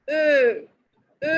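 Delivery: "এ" said with lips rounded narrow; ö sound. Shown as a demonstration. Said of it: "মুখটা সরু করে বলবো". (ঠোঁট সরু করে "এ")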